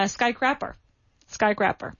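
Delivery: skycrapper, said with a short a as in crap; wrong pronunciation. Retold or imitated. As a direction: imitate the radio error skycrapper. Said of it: "That's wrong".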